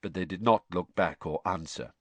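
ordinary delivery

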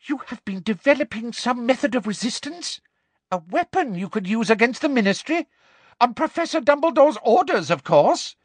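high, silky, probing; high-voiced Umbridge probing for a plot; Umbridge's sweet menace. A narrator's reading. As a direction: coax out the confession. Umbridge's sugary interrogation.

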